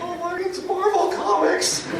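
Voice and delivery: high, slow, mocking singsong; mock fan squeal. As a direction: throw their squeal back at them, hammed up. Belushi mocks the fans' squeal.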